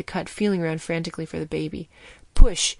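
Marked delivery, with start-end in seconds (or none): 2.3-2.8 loud sharp order. A narrator's reading. Surgeon ordering the doctor to push, loud. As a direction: bark the command. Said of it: "she ordered me".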